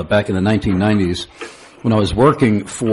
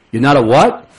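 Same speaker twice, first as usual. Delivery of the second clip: put-on Brooklyn accent; 4 semitones higher, 4 dB louder than usual. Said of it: "a very thick Brooklyn accent".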